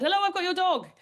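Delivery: high-pitched friendly caller, imitated; nice man phoning. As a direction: voice a helpful stranger, pitched well up. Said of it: "luckily this nice Irish man".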